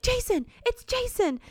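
high, eager shouted answer; mock frantic blurt. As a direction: blurt it out desperately.

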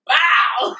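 loud, high explosive "bow"; puking noise, sudden and loud; imitated vomiting sound. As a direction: burst it out like a sudden heave. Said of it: "This shit said".

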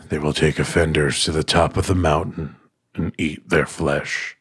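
low, halting, solemn voice with pauses; solemn warning. A narrator's reading. Bob warns the men about mountain guardians, solemn. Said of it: "he said solemnly".

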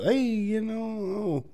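high sing-song imitation of a girl; mock sweet girl. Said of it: "like, girl".

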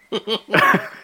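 loud high laugh; burst of laughter. Real, not acted. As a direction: break out laughing. Laughing at the accent joke.

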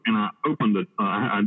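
ordinary delivery

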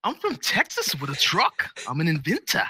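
high mock Boston-guy voice, slowing on Texas; hammed-up Wahlberg voice; Mark Wahlberg impression. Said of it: "with his Boston accent".

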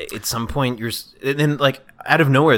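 ordinary delivery

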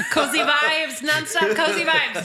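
slow, loud laughing words. Real, not acted. Laughing while teasing friend.

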